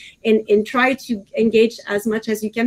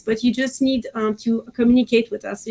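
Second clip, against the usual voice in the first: ordinary delivery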